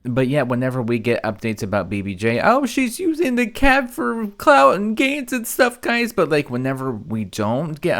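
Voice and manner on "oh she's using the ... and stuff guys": high, slow mocking viewer voice; mocking whiny viewer. Host mimicking chat complaining about cat updates, high and mocking. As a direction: parrot the complainers, much higher and slow.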